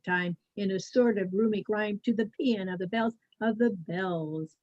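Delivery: ordinary delivery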